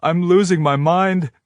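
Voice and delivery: high, panicked voice. A narrator's reading. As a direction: lose it a little. She panics.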